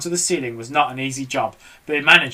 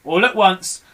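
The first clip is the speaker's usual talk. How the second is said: ordinary delivery, nothing marked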